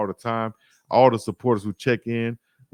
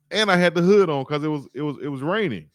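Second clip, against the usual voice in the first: normal talking voice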